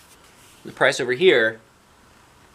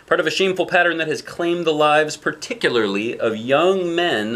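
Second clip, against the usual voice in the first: news report read; 6 semitones higher, 4 dB louder than usual. Retold or imitated. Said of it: "says the T V".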